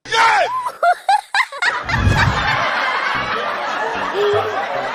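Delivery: shrieking high laughter. Real, not acted. Leader laughs loudly.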